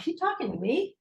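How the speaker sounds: high, rising disbelief; incredulous. Retold or imitated. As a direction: glance around and ask it in shock. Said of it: "I'm looking around like".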